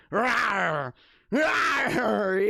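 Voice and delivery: loud playful wolf roar; drawn-out "rawr" roar. Pretend. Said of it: "He's roaring".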